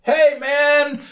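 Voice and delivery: very high, put-on hawker voice; vendor greeting. Market vendor greeting the speaker, very high put-on. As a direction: sing out a hello like a stall seller. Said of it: "they'd say".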